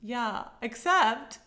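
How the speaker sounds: usual speaking voice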